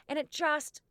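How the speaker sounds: normal talking voice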